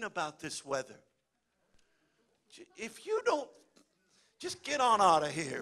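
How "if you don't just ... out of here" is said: ordinary talk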